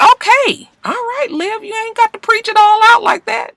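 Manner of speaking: put-on very high, squeaky exasperated girl; whiny mock Quinn. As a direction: squeal it like an annoyed friend giving in. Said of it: "She was just like".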